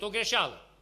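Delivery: ordinary talk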